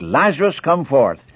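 high, commanding summons; calling out. Acted. Jesus calling Lazarus from the tomb, commanding. Jesus calls the dead man out.

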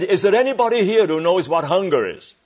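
high, loud call out to the hall; retold loud question. Preacher retelling his question to the congregation, loud and high. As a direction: call the question across the church.

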